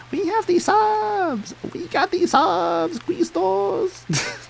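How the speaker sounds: very high, drawn-out creature squeal; weird ad creature. Retold or imitated. Streamer recalling the weird sandwich ad creatures, very high creature impression. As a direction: imitate a silly creature singing out a slogan, stretched and high. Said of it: "I remember the weird creatures in the Quiznos ad".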